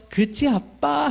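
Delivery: lightly voiced little girl, drawn out; child asking dad. Recalling daughter's question.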